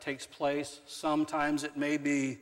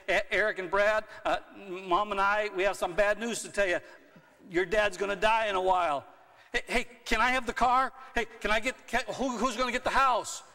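mock greedy sons; 7 semitones higher, 4 dB louder than usual. Dad voicing greedy sons.

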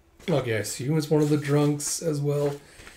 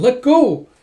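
loud, high desperate demand; acted shout; 10 semitones higher, 11 dB louder than usual. Girl hammering on the drunk choking her, shouting. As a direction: play someone fighting free of a grip. Acted struggle, being choked.

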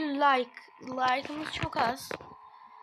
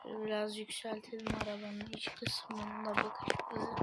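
softer whispered aside; whispering; 3 semitones lower, 7 dB quieter than usual. Real, not acted. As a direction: whisper it to yourself. Quiet aside while adjusting camera. (fısıltı)

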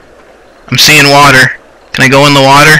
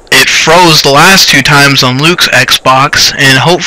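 ordinary delivery

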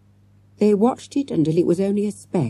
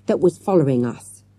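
usual speaking voice

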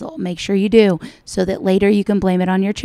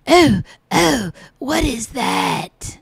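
mocking echo; 4 semitones higher, much slower than usual. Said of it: "That's what everybody says".

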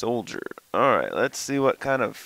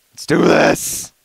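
loud pep; 4 semitones higher, 10 dB louder, slower than usual. Real, not acted. Psyching up.

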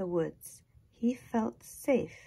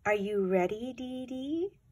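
chickadee chirp; 4 semitones higher than usual. A narrator's reading. Chickadee call.

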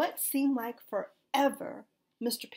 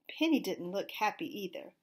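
ordinary delivery

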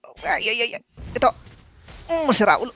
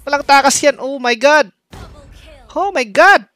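excited; 6 semitones higher, 7 dB louder than usual. Real, not acted. Excited streamer chasing kill.

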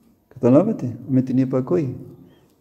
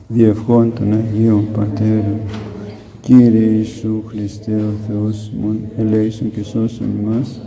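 slow, lower intoned blessing; a slow, lower closing blessing intoned in church style; intoned prayer; 5 semitones lower, slower than usual. Acted. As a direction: intone the prayer slowly and solemnly.

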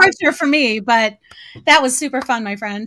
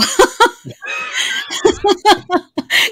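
nervous laugh; 10 semitones higher than usual. Real, not acted. Nervous laugh before singing.

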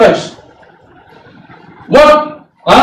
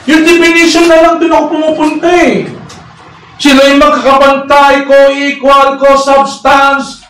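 ordinary talk

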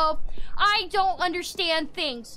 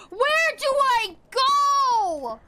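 high, very drawn-out cry; slow, high howl of frustration; exasperated wail; 7 semitones higher, 4 dB louder, much slower than usual. Real, not acted. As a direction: wail it, stretching every word. Lost, wailing in frustration.

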